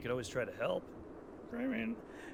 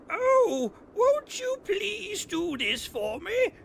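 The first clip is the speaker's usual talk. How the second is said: much higher, slow, hammed-up old lady plea; cheesy old lady; 7 dB louder, much slower than usual. Gamer mocking a game's fake old lady, hammed-up and high. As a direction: overdo a creaky old lady begging. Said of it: "trying so hard to be an old lady".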